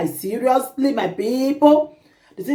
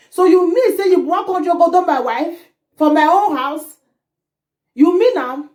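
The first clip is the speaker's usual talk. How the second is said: raised pitch, indignant challenge; angry confrontation; 6 semitones higher than usual. Acted. Husband confronting the pastor over his wife, indignant. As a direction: confront him in outraged disbelief.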